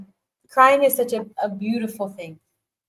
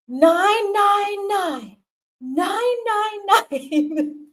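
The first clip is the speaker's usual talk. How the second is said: higher, very slow drawn-out mock yell; stranger yelling; 11 semitones higher, 3 dB louder, much slower than usual. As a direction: imitate a random man calling out at you. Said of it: "just yells at me".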